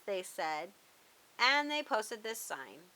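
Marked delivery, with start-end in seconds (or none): none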